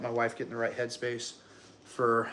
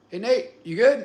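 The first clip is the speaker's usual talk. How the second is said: loud, higher-pitched imitation of doctor; calling out; 9 semitones higher, 10 dB louder than usual. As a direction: call over to check on him, loudly. Doctor calls out to him, retold.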